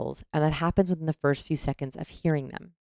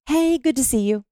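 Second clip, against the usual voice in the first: higher-pitched hello to someone; demonstrated greeting; 9 semitones higher, 3 dB louder than usual. Shown as a demonstration. Speaker showing how tone signals things, high-pitched greeting. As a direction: greet them with a raised pitch. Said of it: "So the way that I say".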